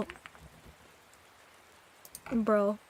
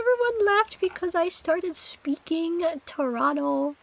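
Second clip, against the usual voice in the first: mock Toronto accent; 5 semitones higher than usual. Repeats line with Toronto accent.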